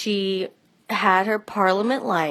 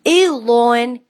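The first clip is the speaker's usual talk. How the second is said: put-on a light New York accent, drawn out slow and loud; 4 semitones higher, 8 dB louder, slower than usual. Seth teasing the girls about the smell, in a mild New York accent. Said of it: "Seth has a New York accent".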